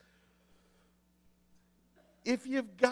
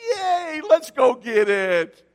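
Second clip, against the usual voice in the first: high whooping yay, then a loud, slow strained wail; sarcastic mock cheer; 5 semitones higher, 8 dB louder, slower than usual. Pastor answering the urologist with hammed-up cheer. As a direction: fake celebration cracking into a cry.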